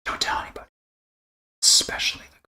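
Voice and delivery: conspiratorial whisper. Acted. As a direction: whisper it like a secret. Secret aside to chat.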